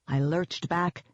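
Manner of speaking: ordinary delivery, nothing marked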